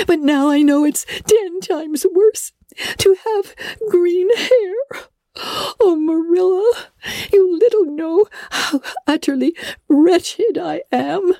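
high, crying lament; a distraught girl crying over her hair; tearful girl. Acted. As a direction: wail it through tears. Anne laments her green hair.

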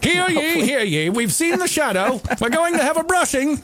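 high, rising town crier call. Pretend. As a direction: proclaim like an old town crier. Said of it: "use all those Victorian sexy terms".

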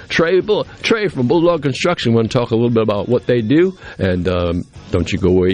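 ordinary delivery

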